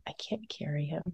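whispered private thought; tired mom whispering to herself; inner thought whispered. Retold or imitated. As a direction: breathe it like a worried thought.

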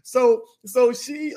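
ordinary talk, nothing marked

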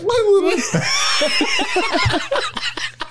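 high squealing mock sob; high squealing cry; shrill laughing wail. Bilbo breaking down.

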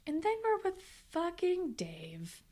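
soft unvoiced whisper; a hushed, whispered complaint; whispered aside. Real, not acted. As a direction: whisper it like a secret gripe. Conspiratorial griping about Dave.

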